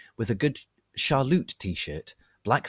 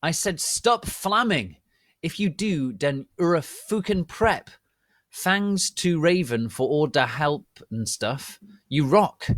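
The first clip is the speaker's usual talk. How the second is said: put-on higher, bratty teen author voice; mock angsty teen author, voice raised; author's note rant; 6 semitones higher than usual. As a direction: read it like a sulky teenage writer. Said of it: "Author's note".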